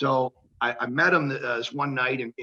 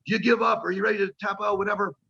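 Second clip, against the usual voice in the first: referee's question voiced higher; referee asking; 7 semitones higher, faster than usual. Referee on the mat asks the wrestler to submit, raised voice. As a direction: ask if he gives up, high and quick. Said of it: "He's like".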